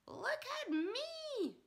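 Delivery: very high, slow shout; Grandma crowing from the rooftop. Acted. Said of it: "Grandma shouted from the rooftop".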